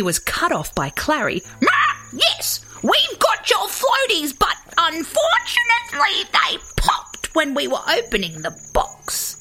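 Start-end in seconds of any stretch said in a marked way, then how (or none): 1.7-9.4 high squawk, dropping off at the end; raucous bird explanation, starting very high; blunt cockatoo. A narrator's reading. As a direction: squawk out the bad news. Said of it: "he explained".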